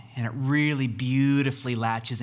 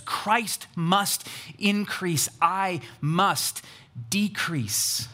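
slow, spaced-out declaration; solemn emphasis; 4 semitones higher, much slower than usual. Retold or imitated. Preacher gives the family its life verse, slow solemn emphasis. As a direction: deliver each word separately, with weight.

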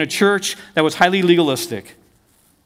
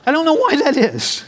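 higher-pitched, said through a laugh; 7 semitones higher than usual. Real, not acted. Dad joking about being last to hear of tattoo, laughing. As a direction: let the laugh carry the words.

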